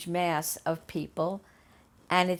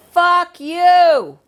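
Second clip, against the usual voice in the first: much higher, much louder full-lung shout; yelling at the reporter from the crowd; furious yell; 12 semitones higher, 17 dB louder than usual. Retold or imitated. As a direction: scream it at the newscaster with everything you have. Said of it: "I just yelled at the top of my lungs".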